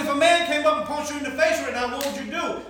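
loud, high, punchy questioning; forceful challenge. Retold or imitated. Pastor challenging Dale to face his conscience, forceful challenge.

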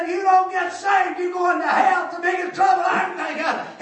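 loud, high hellfire warning; shouted preaching. Retold or imitated. Street preacher shouting back at the angry man, loud and high. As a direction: preach it back at him full force.

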